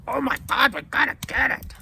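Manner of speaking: high, loud, gushing excitement; excited girlfriend impression. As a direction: squeal it like an excited fan. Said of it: "And she's like".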